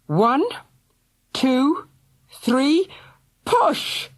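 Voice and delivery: rising to a very high shout; building count. A narrator's reading. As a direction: count up and heave. Counting a hard push.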